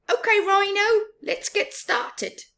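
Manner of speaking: high, loud eager Baboon voice; pleased with his plan, lightly voiced; high bright Baboon voice; cheerful Baboon. A narrator's reading. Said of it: "he said with a smile".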